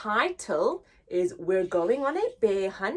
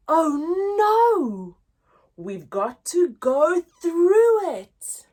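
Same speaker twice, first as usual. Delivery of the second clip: mock dismay; 7 semitones higher, 10 dB louder, slower than usual. Story refrain, dismayed exclaim.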